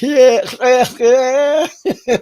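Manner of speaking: high, loud cheers through slapping water; exhilarated whooping. Retold or imitated. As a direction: re-enact a thrilled ride, yelling. Acting being towed fast by dolphin.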